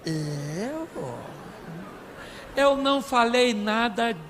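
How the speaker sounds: slow, put-on innocent denial; denying husband. Husband denying what he said to crying wife, slow put-on innocence. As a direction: stretch each word in mock disbelief. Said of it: "Sabe o que ele fala?".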